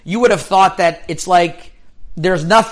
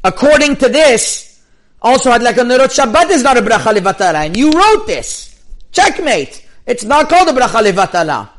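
triumphant retold argument; 5 semitones higher, 4 dB louder than usual. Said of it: "he says".

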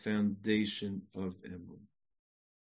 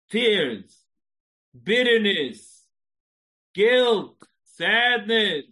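much higher and louder, building; preaching cry; 7 semitones higher, 10 dB louder, slower than usual. Real, not acted. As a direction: hammer each trouble home. Preaching list of troubles.

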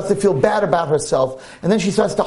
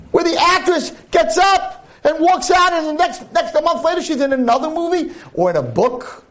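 high-pitched impassioned sermon, pushing hard; fiery preaching; 12 semitones higher than usual. Acted. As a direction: preach it to the ladies at full force. Preaches to the women.

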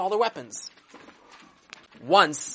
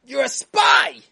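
furious shout; 8 semitones higher, 11 dB louder, slower than usual. Retold or imitated. Napoleon screams at the seated translator, a loud accusing shout. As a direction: bellow the accusation high and loud. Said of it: "he screams at him".

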